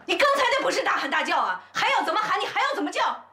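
high-pitched indignant comeback; angry retort. Acted. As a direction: hurl the accusation back at her. 婆婆高声反驳. (尖着嗓子愤怒回击)

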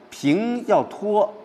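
平 and 托 held long and high; drawn-out level tone. Shown as a demonstration. Teacher shows how level tones are sustained, drawn-out 平 and 托.

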